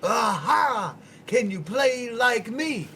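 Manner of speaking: loud, high, slow show-off uncle bragging; drunk uncle. Retold or imitated. Uncle bragging loudly to his little nephew. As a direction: crow it like a tipsy uncle showing off. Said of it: "he's like".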